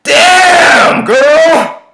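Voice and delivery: long, drawn-out high holler; full-voiced, high shout; catcall yell. A narrator's reading. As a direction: yell a leering catcall from a car window. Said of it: "and yells".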